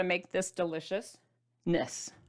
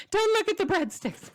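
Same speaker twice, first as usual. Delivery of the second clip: high, squealing mock panic at the breadsticks; mock horror; 11 semitones higher than usual. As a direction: shriek as if shielding kids' eyes, playfully.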